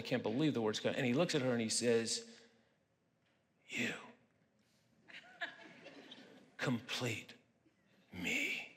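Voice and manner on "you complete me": slow, hushed, drawn-out romantic line; hammed-up whispered declaration of love; Tom Cruise. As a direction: breathe each word like a lovestruck hero. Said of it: "That's exactly how he said it".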